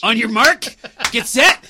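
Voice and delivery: starter's call. Shown as a demonstration.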